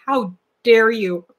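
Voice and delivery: slow, mock-offended gasp of outrage; mock indignation. To the comic's writers, slow mock outrage. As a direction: act scandalized at the comic's description. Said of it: "I'm like".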